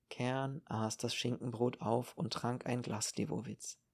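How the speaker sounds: ordinary delivery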